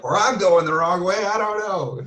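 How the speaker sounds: high, unsure quoted afterthought; imitating Doug. Doug correcting himself behind the speaker, high puzzled impression. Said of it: "he's like".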